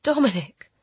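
high gasp of a name. A narrator's reading. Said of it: "I gasped".

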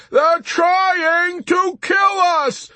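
slow, high, word-by-word scream; frustrated shriek. A narrator's reading. Yossarian spelling out the danger to Arfi, slow frustrated shriek. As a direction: stretch out every screamed word. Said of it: "Yossarian shrieked in frustration".

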